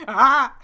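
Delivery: short loud startled "ah"; exasperated yelp. Pretend. Imitating the startled man on the plane wing, a yelp. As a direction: blurt a caught-off-guard cry.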